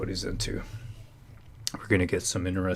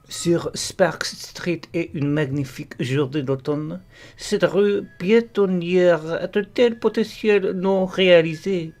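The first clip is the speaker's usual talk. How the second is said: raised, theatrical European French; put-on European French reading voice; European French accent; 10 semitones higher, 8 dB louder than usual. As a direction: declaim it like a Frenchman. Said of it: "that's my European French accent".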